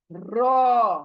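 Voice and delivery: रॉ full-mouthed and held; thick rounded रॉ; heavy ra. Shown as a demonstration. Teacher modelling the heavy letter. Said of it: "मोटा वाला है".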